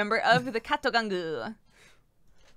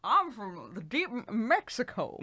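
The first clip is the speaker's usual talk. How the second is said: goofy Southern put-on with a laugh; mock accent; 3 semitones higher than usual. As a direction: parody the accent, breaking up laughing.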